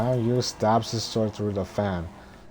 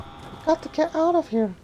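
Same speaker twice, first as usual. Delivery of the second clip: put-on high falsetto girl voice; squeaky high-pitched heroine; Mulan voice; 4 dB louder than usual.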